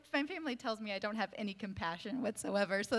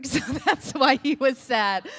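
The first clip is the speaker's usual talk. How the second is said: loud, laughing delivery; higher and louder through a real laugh; amused laughing; 4 semitones higher, 10 dB louder than usual. Real, not acted. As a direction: crack up as you say it. Laughing at a silly explanation.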